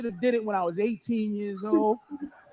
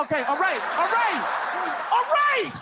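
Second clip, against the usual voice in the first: panicked all right growing to a shriek; building panic; 7 semitones higher than usual. Retold or imitated. Panicked stalling under gun.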